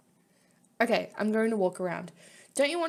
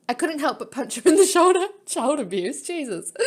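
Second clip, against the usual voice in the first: normal talking voice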